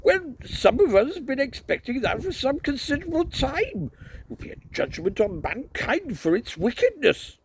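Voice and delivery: high, loud fire-and-brimstone crank; righteous, high-pitched harangue; zealous doomsaying priest. A narrator's reading. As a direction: rant indignantly at a high pitch, then drop the volume.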